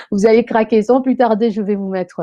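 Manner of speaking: high, loud, amused delivery; amused excitement. Real, not acted. Host resumes her plug after cracking up, amused and excited. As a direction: carry on brightly straight out of a laugh. Carries on right after laughing.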